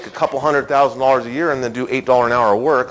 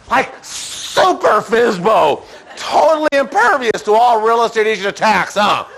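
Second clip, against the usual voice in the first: slow, higher, laughing mock shout; laughing mock superhero build-up; laughing mock hype; 6 semitones higher than usual. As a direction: hype him up as a superhero, joking. Mocking the stubborn seller.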